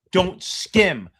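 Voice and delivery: ordinary delivery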